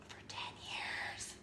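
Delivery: awed whisper, slow. Real, not acted. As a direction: breathe it out in awe. Whispered for effect.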